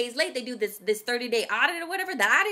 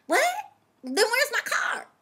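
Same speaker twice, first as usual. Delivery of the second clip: indignant disbelief; 12 semitones higher, 3 dB louder than usual. Retold or imitated. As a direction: demand an answer, acting out the shock. Retold disbelief at dispatcher's news.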